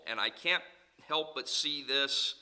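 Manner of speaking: usual speaking voice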